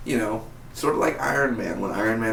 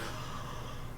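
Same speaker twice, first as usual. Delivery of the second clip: imitated gasp; 17 dB quieter than usual. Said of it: "He's like".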